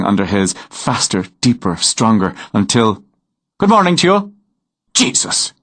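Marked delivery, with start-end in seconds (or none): 3.6-4.3 cheerful, much higher Irish lilt; sudden friendly Irish hello from behind; Irish brogue greeting. Acted. Stranger greets startled writer. 4.9-5.5 breathy whispered jolt of fright; jumping at a sudden voice; startled gasp. Acted. Startled out of a daydream.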